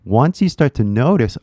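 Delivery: usual speaking voice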